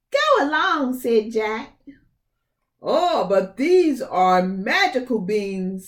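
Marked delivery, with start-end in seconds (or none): 0.1-1.0 high, loud scoff of disbelief; scoffing Jack. Acted. Jack scoffing at the bean seller, high and loud. 2.8-5.9 slow tempting bean seller. Acted. The man tempts Jack.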